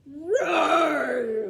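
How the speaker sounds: loud roaring dragon cry. Acted. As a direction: roar like a huge dragon. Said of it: "roared the dragon".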